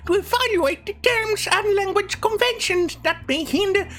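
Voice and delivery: very high, airy mocking falsetto; mocking soy voice. Mocking the task force memo in a high falsetto. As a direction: squeak it out like a fragile campus bureaucrat. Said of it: "a proper voice that reflects the soyness".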